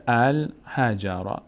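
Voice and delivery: الحجر slowly, each syllable distinct; sounded-out example. Shown as a demonstration. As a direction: spell out الحجر by ear for learners. (شمرده و هجا به هجا)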